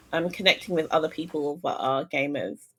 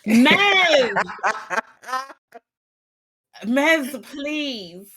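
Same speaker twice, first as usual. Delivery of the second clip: pleading call; 7 semitones higher, much slower than usual. Real, not acted. Pleading to friend who arrived.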